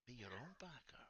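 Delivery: tempting whisper. Acted. Corbin tempting Tomasi to buy him out, whispered. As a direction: whisper it like a devil on his shoulder.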